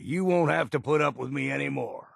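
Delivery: ordinary talk, nothing marked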